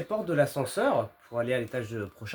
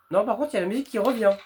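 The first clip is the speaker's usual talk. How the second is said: excited gamer; 6 semitones higher, 4 dB louder, faster than usual. Real, not acted. Music returns in game.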